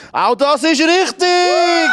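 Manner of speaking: high and slow, drawn out; excited announcer. Acted. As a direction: proclaim it like a game show host. Host announces a correct answer.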